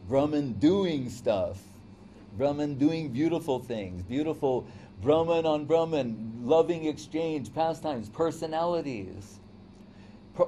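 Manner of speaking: ordinary talk, nothing marked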